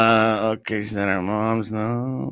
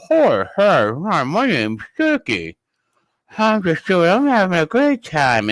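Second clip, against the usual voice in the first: lightly put-on, higher-pitched mimicry; Doc impression; 5 semitones higher than usual. Mocking how Doc talks on drugs, lightly voiced impression. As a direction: imitate him on drugs, a little higher. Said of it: "which drugs Doc's on because Doc will do".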